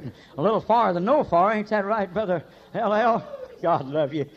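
usual speaking voice

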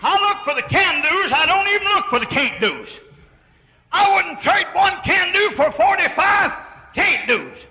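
high, loud preacher's cry; ringing sermon voice; preaching. Acted. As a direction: preach it out with full force. Preacher rising to full force.

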